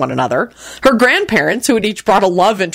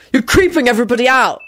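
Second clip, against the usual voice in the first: higher-pitched irritated bark; snappish, raised-pitch protest; barked complaint; 5 semitones higher than usual. A narrator's reading. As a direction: bark it at a creepy relative. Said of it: "Jamie barked out".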